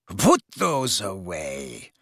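low, slow and icy command; a haughty mother warning the boys off; cold aristocrat. A narrator's reading. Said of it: "she said coldly".

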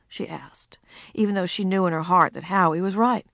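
ordinary delivery

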